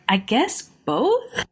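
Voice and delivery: mock sheepish and questioning; hesitant admission. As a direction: mimic her reluctantly offering an answer, drawn out. Mimics lady's sheepish answer.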